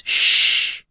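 sharp unvoiced hush; hushing shh. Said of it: "hushed the elf".

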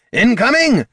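high, loud battle cry; urgent full-voiced alarm call; shouted warning. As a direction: yell a warning to a comrade.

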